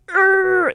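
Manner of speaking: high, loud groan of delight; loud gushing "urgh"; excited groan. Real, not acted. Gushing over a favourite album.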